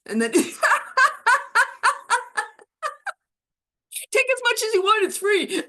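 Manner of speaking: high giggling through the words; laughing. Shown as a demonstration. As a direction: laugh while you speak. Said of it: "here we go on three".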